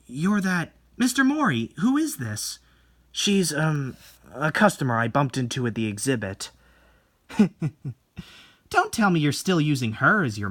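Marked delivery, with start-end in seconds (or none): none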